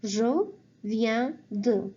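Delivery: "Je viens de" drawn out slowly; slow model phrase. Shown as a demonstration. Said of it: "you say".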